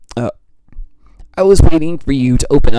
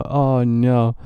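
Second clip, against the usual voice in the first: ordinary talk, nothing marked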